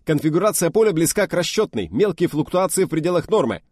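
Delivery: high, loud snappy report; brisk reply. Acted. Officer reporting to the test leader, loud brisk reply. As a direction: rap it out smartly.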